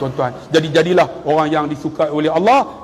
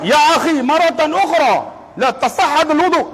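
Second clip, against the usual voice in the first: raised, insistent repeat of the sheikh's correction; 9 semitones higher than usual. Retold or imitated. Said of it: "saya pergi nak semayang".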